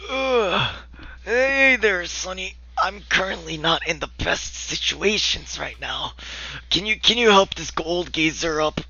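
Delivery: put-on high, creaky old man voice. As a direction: play a frail old fisherman. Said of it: "He looks like a very old man".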